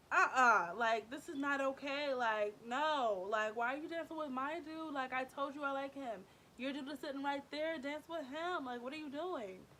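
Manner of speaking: acted-out jealous complaint; indignant protest. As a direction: object firmly, as if scolding a friend. Inner protest at friend's flirting.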